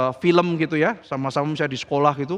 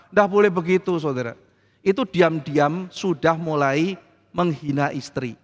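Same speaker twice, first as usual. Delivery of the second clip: ordinary talk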